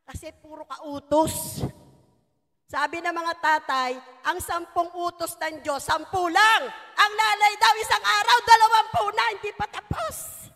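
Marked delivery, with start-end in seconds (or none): 2.6-10.5 louder, higher building joke; preacher ribbing the mothers for a laugh; loud punchline. Real, not acted. Joke about nagging mothers.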